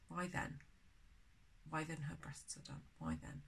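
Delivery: very soft, private trying-out of words; muttering to oneself. Real, not acted. Teacher unsure where the stress falls, murmuring to herself. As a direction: test the phrase under your breath.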